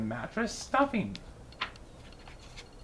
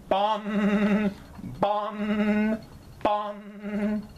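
higher booming bong of a church bell; bell imitation; 6 semitones higher, much slower than usual. A narrator's reading. Narrator imitates the church bell ringing, chanted high. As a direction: chant it like a big bell tolling.

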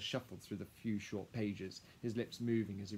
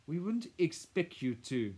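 condescending interjection; 8 semitones higher, 8 dB louder, slower than usual. A narrator's reading. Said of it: "Madame cut in".

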